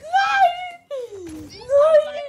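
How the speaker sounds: high shouted no through laughter; laughing shout. Real, not acted. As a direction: yell it while cracking up. Laughing protest mid game.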